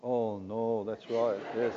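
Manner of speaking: ordinary talk, nothing marked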